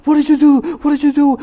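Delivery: high, loud alarmed cry; panicked parent. Shown as a demonstration. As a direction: overreact like a frantic parent at a scratch. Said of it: "would it help to a child if you came and said".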